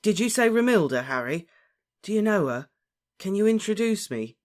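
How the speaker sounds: high, loud, eager pleading; eager, hopeful. A narrator's reading. Lovesick Ron begs an introduction, eagerly hopeful. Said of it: "now looked tremendously hopeful".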